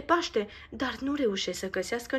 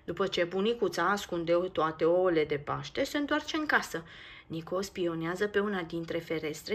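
usual speaking voice

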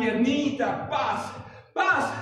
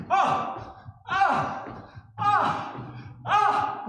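short high yells of a playful tantrum; mock tantrum yells; 7 semitones higher, much slower than usual. Shown as a demonstration. Instructor shows the class a playful tantrum, yelling. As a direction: let out a fake tantrum cry, rising each time. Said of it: "También se vale hacer berrinche".

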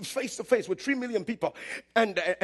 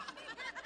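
high laugh; 18 dB quieter than usual. Real, not acted. Laughing at his own story.

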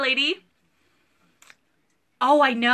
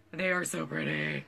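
soft admiring sigh; 6 semitones lower, 10 dB quieter than usual. Real, not acted. Admiring leggings softly.

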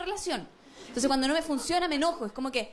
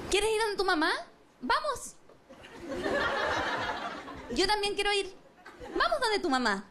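mock sweet agreeing; 5 semitones higher than usual. Acts the opposite of her wish.

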